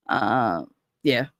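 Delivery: usual speaking voice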